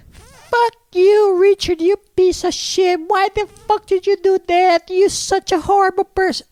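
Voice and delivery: very high screechy falsetto rant; mock shrieking fury. Imitating the girl cursing Richard before her father, mock shrieking. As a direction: screech it like an outraged girl, hammed up.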